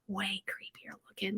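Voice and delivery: slow eerie whisper; creepy whisper. Acted. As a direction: whisper it like a spooky secret. Describing a creepy doll.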